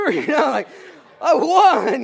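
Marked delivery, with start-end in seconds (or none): 1.2-2.0 high, loud hammed-up victory cry; mock triumphant shout. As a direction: crow about the win despite the pain. Mock wrestler boasting while hurt.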